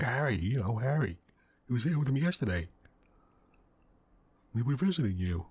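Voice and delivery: mildly angry as you act out the quote, pressing the point; getting angry. Retold or imitated. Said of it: "he's getting mad. He's getting, like, angry".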